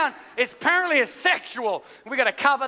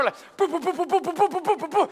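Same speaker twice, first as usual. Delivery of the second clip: bouncy boo sound effect; 5 semitones higher, faster than usual. Retold or imitated. People falling like dominoes.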